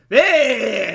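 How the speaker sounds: sudden loud hey; mock exclaim. Jack Nicholson greeting a patient, a loud hey. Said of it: "Jack Nicholson goes".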